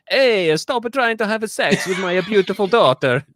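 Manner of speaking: loud, high mock-Italian outburst; heavy Italian accent. Host mocking an actor as angry father, heavy Italian accent. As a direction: play an indignant father in a broad Italian accent. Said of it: "Fazzetti's heavy Italian accent".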